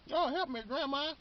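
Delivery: high-pitched mock plea for help; pleading grandson. Man on the run begging his grandma, high mock plea.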